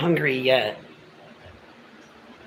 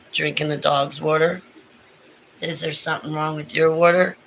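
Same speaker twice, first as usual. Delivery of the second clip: ordinary talk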